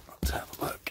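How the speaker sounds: soft hushed whisper; excited wildlife host whisper. Real, not acted. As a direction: whisper like a hunter in the woods. Whispered in the field.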